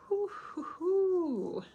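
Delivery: guilty hooting exclamation; a knowing high hoo-hoo. Real, not acted. Reacting to avoiding conflict.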